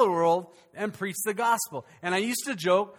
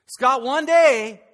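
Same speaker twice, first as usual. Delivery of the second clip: very loud, higher, slow; mock pompous call-out; 6 semitones higher, 12 dB louder, much slower than usual. Pastor mocks waiting to become good enough, loud and slow. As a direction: drag it out in a big sarcastic boom.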